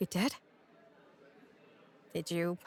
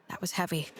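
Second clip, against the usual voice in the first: normal talking voice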